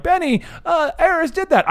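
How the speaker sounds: high-pitched imitation of a nitpicking viewer; mock viewer complaint. Host mimics viewers who point out the idea was taken, high-pitched. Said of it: "So before people are like".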